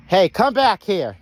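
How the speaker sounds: loud, high call to a runaway. Real, not acted. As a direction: shout sharply to call him back. Calling his runaway Jake back.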